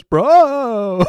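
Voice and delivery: mock vocoder voice, high and loud; stretched, loud fake-electronic bro; vocoder effect. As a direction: imitate a vocoded catchphrase, drawn out. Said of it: "a little vocoder effect".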